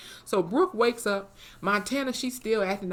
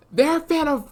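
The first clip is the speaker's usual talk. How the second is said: excited; 8 semitones higher, 6 dB louder than usual. Pretend. Repeated line climaxing.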